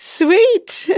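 put-on a burst of girlish delight; gushing delight.

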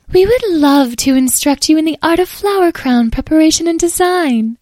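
very high, loud fairy voice; eager, lofty little fairy; gracious fairy. Acted.

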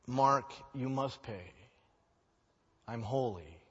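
God declaring justice to the sinner. Acted. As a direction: pronounce the sentence gravely. Voicing God's justice.

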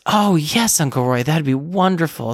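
mock delighted child, jumping high on oh yes; a kid thrilled by her uncle's offer, lightly voiced; imitating an eager niece who says yes at once; excited little girl. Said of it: "she looks and she says".

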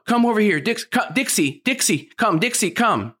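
high, rushed, nagging string of commands at the dog; frantic repeating owner. Shown as a demonstration. Owner flustered by a lunging puppy, pleading and nagging. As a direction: play the panicky owner who keeps yelling the dog's name. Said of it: "this is what I see most people do".

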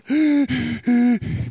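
high, sharp intake of breath; shocked gasp. Pretend. Sisters scandalised by the luxury, a loud shocked gasp.

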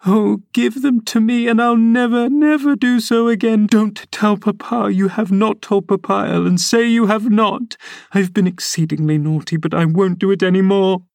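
put-on high, frantic girlish begging; girl begging her nurse not to tell Papa; pleading girl. A narrator's reading. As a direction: beg for mercy, near tears. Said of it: "she commenced immediately".

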